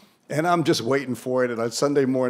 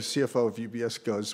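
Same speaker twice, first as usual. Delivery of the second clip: normal talking voice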